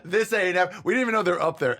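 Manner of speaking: high and loud, laughing; excited. Real, not acted. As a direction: tell it gleefully.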